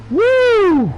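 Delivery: high, loud "woo"; excited whoop. Real, not acted. Commentator reacting to a wild race finish, loud high whoop. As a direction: let out a soaring cheer.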